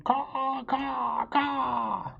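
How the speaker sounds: high cawing crow song. Acted. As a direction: caw it out like a proud crow singing. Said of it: "She opened her beak and sang".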